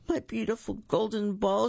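high, mildly tearful wail; a touch of tears over the lost ball; sobbing. Acted. Princess sobbing over her lost golden ball, mild tearful wail. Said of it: "she sobbed".

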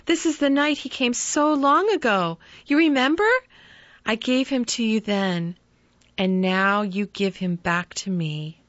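mother thanking husband, tearful joy; grateful mother. Acted. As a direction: pour out her gratitude to her husband.

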